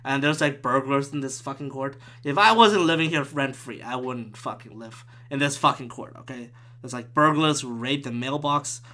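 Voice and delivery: usual speaking voice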